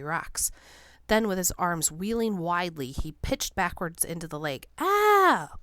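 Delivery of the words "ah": high loud falling yell; bellowed cry. A narrator's reading. Beloit pitching backwards into the lake, bellow. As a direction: yelp as you topple. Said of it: "Beloit bellowed".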